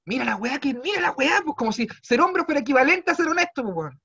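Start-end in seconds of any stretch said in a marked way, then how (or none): none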